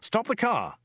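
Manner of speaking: high, urgent call; sudden shout. A narrator's reading. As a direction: cry out suddenly, high-pitched. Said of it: "shouted Dirk suddenly".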